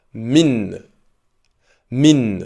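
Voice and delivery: teacher repeats Min for imitation; model pronunciation. Shown as a demonstration. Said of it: "Répète après moi". (prononciation modèle de min)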